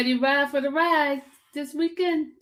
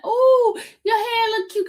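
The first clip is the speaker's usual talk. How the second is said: imitating the lady; 11 semitones higher, 5 dB louder than usual. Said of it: "the lady was like".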